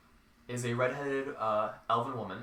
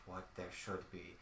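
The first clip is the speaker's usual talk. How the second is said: distant slow murmur; 5 semitones lower, 15 dB quieter, slower than usual. Acted. NPC elf woman speaks.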